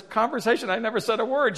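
high, incredulous protest; mock bafflement. Husband answers crying wife, high-pitched mock disbelief. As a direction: query it as if utterly baffled.

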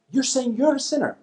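very high incredulous question; imitated disbelief. Heckler questioning the debater's admission, incredulous. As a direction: echo it back in mock astonishment.

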